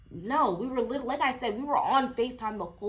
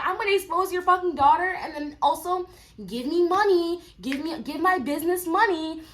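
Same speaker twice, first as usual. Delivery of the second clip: higher and louder, a sneering caricature of the pushy mom; mock her demands with scorn; mocking the lady; 9 semitones higher, 4 dB louder than usual. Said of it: "she's like".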